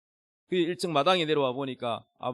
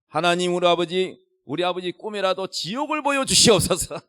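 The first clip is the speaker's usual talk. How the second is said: loud, higher-pitched re-enacted plea to God; forceful prayer; 3 semitones higher, 7 dB louder than usual. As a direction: pray it out hard, as retold to a congregation. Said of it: "세게 했습니다".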